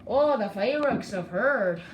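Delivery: slow, lilting bad Irish accent; terrible Irish accent. Shown as a demonstration. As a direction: put on a badly done Irish brogue, drawn out. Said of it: "terrible Irish accent".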